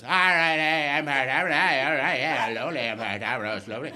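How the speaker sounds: low, garbled Belfast cabbie babble; comically incomprehensible Northern Irish mumbo-jumbo; unintelligible Northern Irish accent. Retold or imitated. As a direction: run it together in thick Belfast accent. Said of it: "for my ear to tune in to the accent".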